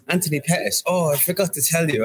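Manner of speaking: put-on high, light German accent for the manager; a playful impression of his German manager; retelling the manager's slip in a mock German accent. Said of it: "in his German accent there".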